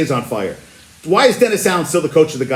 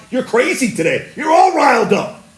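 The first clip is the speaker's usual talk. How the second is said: higher, playful shouting jab; teasing shout; 6 semitones higher than usual. Real, not acted. Teasing cohosts who are riled up.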